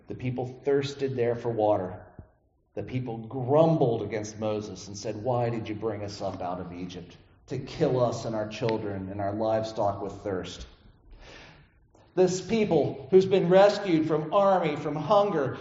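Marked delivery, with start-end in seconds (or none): 0.1-10.7 lower, softer reading of the text; scripture reading. Acted. Pastor reading the passage to the congregation, a grave scripture read.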